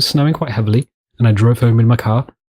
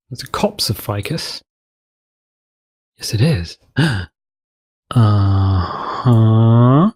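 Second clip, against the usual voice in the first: usual speaking voice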